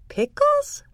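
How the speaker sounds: high squeaky rising question; surprised. A narrator's reading. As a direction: squeak it out, amazed. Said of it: "I said".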